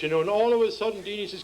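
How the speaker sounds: ordinary delivery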